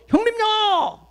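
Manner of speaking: high call of greeting to an elder brother; father greets his big brother on the phone, calling high. Retold or imitated. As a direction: call out warmly over the line.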